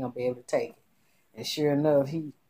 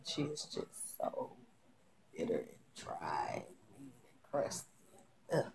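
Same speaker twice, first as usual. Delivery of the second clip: disdainful whisper; 7 semitones lower, 14 dB quieter than usual. Real, not acted. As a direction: hiss it with disdain. Disdain for a character.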